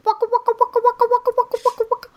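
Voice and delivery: high chomping waka waka noise; Pac-Man sound. Retold or imitated. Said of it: "Pac-Man sounds".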